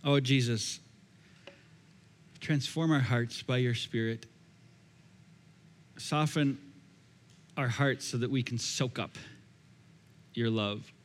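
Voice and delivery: low, soft prayer voice; closing prayer. Real, not acted. Pastor leads closing prayer.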